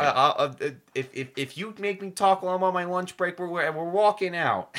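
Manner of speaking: loud, high, playful outrage; mock angry shout. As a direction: yell it like a cranky worker on break. Threatens walkout, joking.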